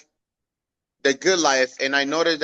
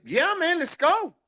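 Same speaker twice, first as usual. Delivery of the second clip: much higher, keen and excited; eager yes; 12 semitones higher than usual. Retold or imitated. Teen eagerly accepting his cousin's road trip offer, excited. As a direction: jump at the offer like a thrilled teen.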